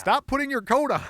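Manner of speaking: high, loud shout; mock scolding. Host jokingly scolding a co-host for getting up, shouted. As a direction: holler an exasperated order.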